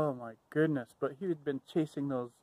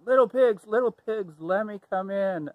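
high, loud wolf call at the door; 5 semitones higher, 5 dB louder than usual. Acted. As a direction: call the pigs out boldly, pitched up. Wolf calling to the pigs.

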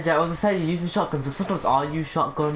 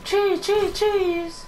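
very high falsetto squeal; excited squeal; slower than usual. Pretend. Gamer cheering at his own play, excited squeal.

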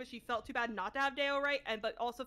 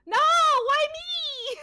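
high, loud, drawn-out protest; mock anguished wail; 11 dB louder, much slower than usual. Player protesting being picked for the recap, mock wail.

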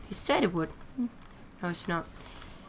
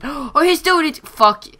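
sudden high alarmed cry, then a louder swear; alarmed shout; 8 semitones higher, 6 dB louder than usual. Real, not acted. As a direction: yelp as the enemy strikes. Sees hacker attack him.

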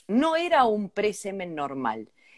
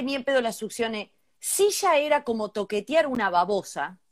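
ordinary delivery

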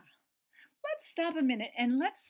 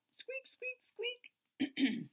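mouse squeak; much slower than usual. Shown as a demonstration. Teacher showing children how mice go, high squeaky. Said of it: "And mouses go what".

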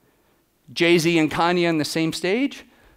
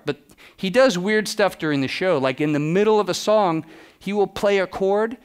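normal talking voice

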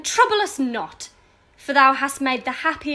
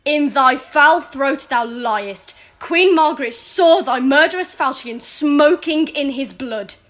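furious accusation; 6 dB louder than usual. Acted. Lady accuses him of murder.

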